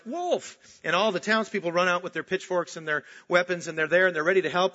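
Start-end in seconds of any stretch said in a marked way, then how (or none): 0.1-0.6 sudden high yelp of Wolf!; boy's mock alarm call; crying wolf. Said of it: "so he cries".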